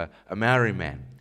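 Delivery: normal talking voice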